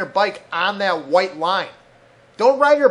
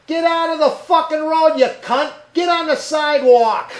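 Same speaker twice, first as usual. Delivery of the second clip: higher, louder shout at a cyclist; retold yell out window; 7 semitones higher, 4 dB louder than usual. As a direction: bellow it out the car window like you mean it. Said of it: "and I yell out".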